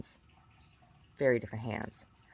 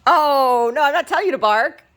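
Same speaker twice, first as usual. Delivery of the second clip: loud high exclaiming; 13 semitones higher, 14 dB louder than usual. Real, not acted. Mom reacts to dog barking.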